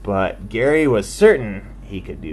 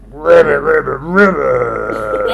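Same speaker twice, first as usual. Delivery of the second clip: frog croak; 3 semitones higher, 7 dB louder than usual. Acted. Frog bonked its head.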